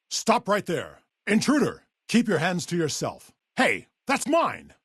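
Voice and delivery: high, sharp warning to an intruder; challenging shout. Acted. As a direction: call out to stop a thief.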